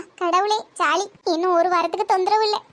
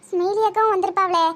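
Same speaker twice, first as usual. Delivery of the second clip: ordinary talk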